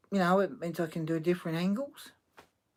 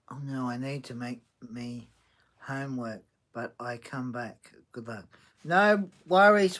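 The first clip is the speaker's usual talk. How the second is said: ordinary talk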